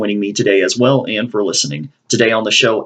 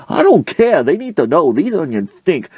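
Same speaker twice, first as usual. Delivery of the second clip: raised voice; 7 semitones higher than usual. Retold or imitated. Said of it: "you need to lower your voice".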